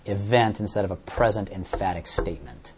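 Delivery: usual speaking voice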